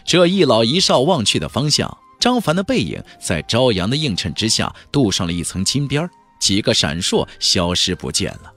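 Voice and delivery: usual speaking voice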